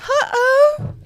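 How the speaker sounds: very high, cartoonish what and uh-oh; squeaky mock alarm. Real, not acted. Pulling a card during the reading, squeaky mock alarm. Reacting to tarot card drawn.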